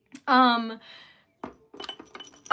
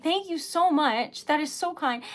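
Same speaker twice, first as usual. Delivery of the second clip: ordinary talk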